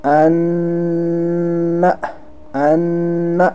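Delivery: "अन्ना" with a long held nn; drawn-out nasal. Shown as a demonstration. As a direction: stretch the nn in "अन्ना" before releasing the final a. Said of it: "बल्कि हमने पढ़ा".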